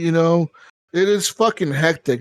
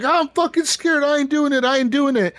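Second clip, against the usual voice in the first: high mock-frightened whine, easing down; scared refusal; 9 semitones higher than usual. Frightened soldier refusing the squad leader's order, mock scared. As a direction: imitate a panicked soldier digging in, pitched high. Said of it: "'Cause they're so scared".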